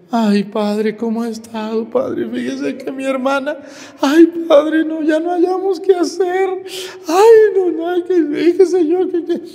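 high, whiny fake sobbing to the priest; mock whining lament. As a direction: ham up a parishioner's crocodile tears, pitched high. Priest mimics whining parishioner.

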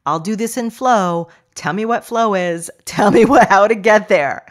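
the keen student begging to know; eager listener voice. Retold or imitated. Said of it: "because you're like".

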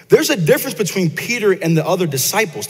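usual speaking voice

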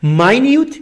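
ماینیوت as "mai-NYOOT"; contrasting pronunciation demo. Shown as a demonstration. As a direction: say ماینیوت with "ai", then long "yoo". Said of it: "هر دو تا تلفظ رو". (تلفظ «ماینیوت» با «آی» و «یو»)

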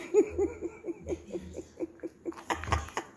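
airy, voiceless giggle; breathless laugh. Real, not acted. Owner amused by her dog and cat, airy giggle.

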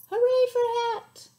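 high, loud cheer. Acted. Reading to a child, cheering the title.

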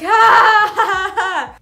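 loud high scream breaking into laughter; laughing scream. Retold or imitated. Reliving the moment, laughing.

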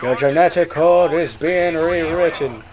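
slow, hammed-up Irish accent; bad Irish accent. Gamer echoing the game, bad Irish accent. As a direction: lay on a terrible Irish accent, slowly. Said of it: "Worst Irish accent ever".

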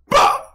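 sudden high, loud "bah"; one sharp, high-pitched blurt; sudden yelp. Real, not acted. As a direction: burst out with a short high yelp. Sudden noise in a lull.

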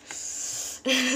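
a short voiceless laugh; breathy laugh. Real, not acted. Laughing at sour taste.

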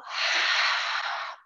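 long breathy exhale sigh; release sigh. Shown as a demonstration. Teacher sighing out breath for kids. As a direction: let it all out in a sigh. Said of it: "Let it go".

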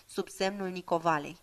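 ordinary delivery, nothing marked

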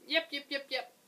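high, loud puppy yaps; a little dog yapping loudly at the guard. A narrator's reading. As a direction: yap like an excited little dog. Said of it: "yap loudly".

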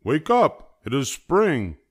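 high, loud wake-up call; loudly rousing a sleeper; excited shout. A narrator's reading. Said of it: "shouted Frog".

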